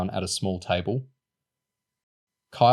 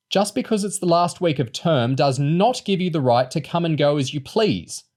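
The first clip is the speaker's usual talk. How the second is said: a raised, scolding teacher's voice, pitched high; angry teacher; 8 semitones higher, 4 dB louder than usual. A narrator's reading. Teacher scolding a pupil who walks out, angrily. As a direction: tell him off sharply. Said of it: "Miss Choke said angrily".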